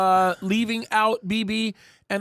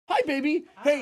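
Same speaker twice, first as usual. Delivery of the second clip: greeting wife; 6 semitones higher than usual. Real, not acted. Host greets his wife at the door, sweet and higher. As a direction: greet your wife sweetly, mid-broadcast. Wife arrives during live show.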